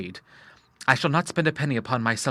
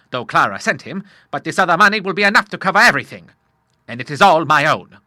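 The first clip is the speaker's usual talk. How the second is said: loud, higher, emphatic father; hearty Admiral; 5 semitones higher, 7 dB louder than usual. Acted. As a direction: reassure your son in bluff style.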